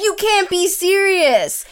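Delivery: slow, high mock disbelief. Retelling the date's stunned reply, slow and high. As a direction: drag out the disbelief in his voice.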